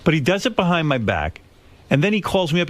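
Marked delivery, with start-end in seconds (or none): none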